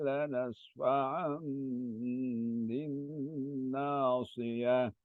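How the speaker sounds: slow melodic Quranic chanting; Quran recitation. Acted. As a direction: recite slowly in tajweed melody. Student recites Quran verses.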